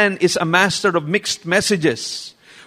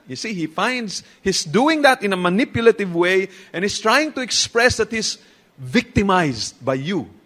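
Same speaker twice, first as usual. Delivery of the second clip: normal talking voice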